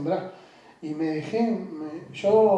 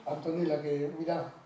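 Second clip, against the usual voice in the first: ordinary talk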